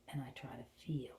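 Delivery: ordinary talk, nothing marked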